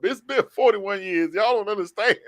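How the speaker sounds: high, loud and thrilled; overjoyed, the long wait is over; excited shout. Real, not acted. Host rejoicing to his cohost over the Dogs' win, excited high shout.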